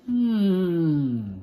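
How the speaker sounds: high, drawn-out plotting hmm; scheming hum. Acted. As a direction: hum while hatching a plan. Miss Nelson plots.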